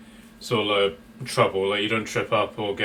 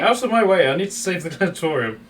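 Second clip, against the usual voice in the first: raised, high-pitched demand to get through; urgent shout; 7 semitones higher, 4 dB louder than usual. Acted. Game master voices a woman shoving a player aside, urgent shout. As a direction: shout it as you push past. Acted barging past to save someone.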